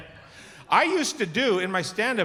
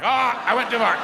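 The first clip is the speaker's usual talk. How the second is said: higher, louder laughing cry of surprise; mock alarmed cry; 8 semitones higher, 6 dB louder than usual. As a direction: yelp as you overshoot into the wall. Head flying back into drywall.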